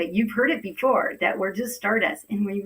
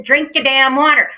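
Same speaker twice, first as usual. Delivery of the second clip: loud, higher, insistent order; emphatic command; 5 semitones higher, 10 dB louder than usual. Real, not acted. Host repeats her order to viewers, loud and emphatic. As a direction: belt it out to drive the order home.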